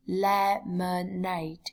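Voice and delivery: "lemonade" slowly broken into le-mo-nade; slow syllable-by-syllable. Shown as a demonstration. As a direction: draw out each syllable of "lemonade". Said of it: "lưu ý cách phát âm". (đọc chậm "lemonade", tách từng âm tiết)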